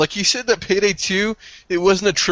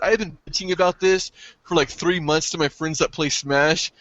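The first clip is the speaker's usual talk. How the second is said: ordinary talk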